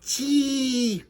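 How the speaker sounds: high, sustained squirrel squeal; piercing high squirrel call; squirrel chatter. A narrator's reading. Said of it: "clattered a red squirrel".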